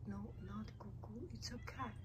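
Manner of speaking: unvoiced whispered aside. Real, not acted. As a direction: whisper the correction to the camera.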